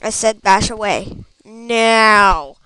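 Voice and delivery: loud, low commanding yell; carried-away shouted order. Pretend. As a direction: bellow the order, exploding on the last word. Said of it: "I got carried away there".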